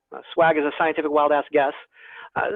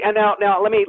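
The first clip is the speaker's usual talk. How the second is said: ordinary talk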